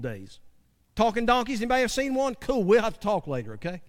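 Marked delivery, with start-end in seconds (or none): none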